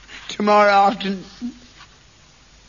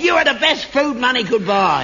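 angry retort; 6 semitones higher, 4 dB louder than usual. Acted. Father and son quarrel.

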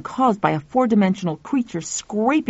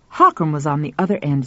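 plain reading voice

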